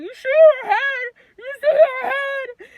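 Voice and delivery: very high, loud, slurred wail; muffled by mask. Retold or imitated. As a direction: wail the question as if through a mask. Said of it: "'Cause I got the mask on".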